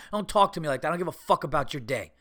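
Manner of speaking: ordinary talk, nothing marked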